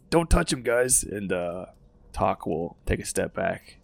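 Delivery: ordinary talk, nothing marked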